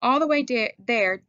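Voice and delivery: ordinary delivery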